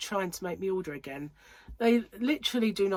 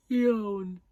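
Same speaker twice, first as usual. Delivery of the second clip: teasing yawn; 3 semitones higher, 4 dB louder than usual. Retold or imitated. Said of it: "She only had to hear the word".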